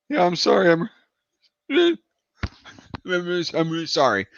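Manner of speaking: high, stammering apology; mimicked nervous apology. Kid apologizing to friend's angry dad, nervous imitation. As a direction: stumble over the apology like a cowed kid.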